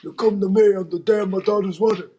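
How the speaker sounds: a hint of a mob boss, stretched out; Godfather impression. Friend's Godfather impression, lightly voiced mob boss. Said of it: "That's my impression".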